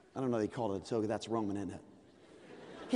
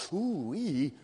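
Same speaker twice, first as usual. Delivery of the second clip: ordinary delivery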